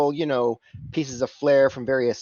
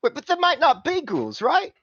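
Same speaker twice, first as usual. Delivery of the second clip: much higher wheedling cockney; pleading fixer; 3 dB louder than usual. Acted. Simon pleading there might be no ghouls, wheedling. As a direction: wheedle them, pitch climbing.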